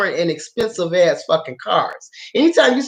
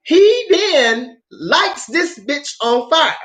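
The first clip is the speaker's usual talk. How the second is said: ordinary talk, nothing marked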